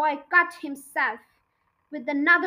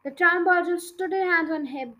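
plain reading voice

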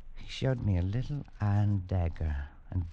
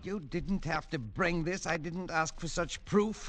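higher, slow, shaken; horrified; 10 semitones higher, slower than usual. Acted. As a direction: recoil in horror. Horrified at the severed head.

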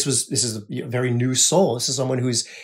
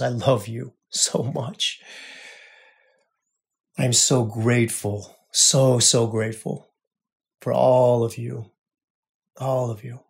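tender sign-off; 2 semitones lower, 3 dB quieter than usual. Real, not acted. Podcast host thanking his listening brothers, softly tender. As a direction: let the gratitude settle quietly.

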